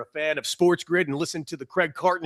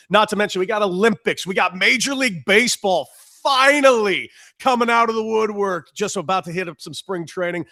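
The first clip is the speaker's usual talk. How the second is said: excited; 6 semitones higher, 6 dB louder than usual. Real, not acted. Hyped listing of sports.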